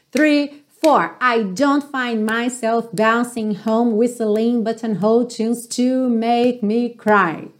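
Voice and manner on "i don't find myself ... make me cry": whole line slowly, linked; slow practice read. Shown as a demonstration. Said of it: "One more time". (prática lenta da frase)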